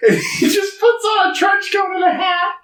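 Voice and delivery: high, breaking into laughter mid-sentence; laughing while talking. Real, not acted. Friend cracking up retelling the turtle in a trench coat, laughing. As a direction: squeal the words through laughter.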